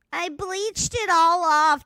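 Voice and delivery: high, loud mocking imitation. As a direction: mimic her bragging.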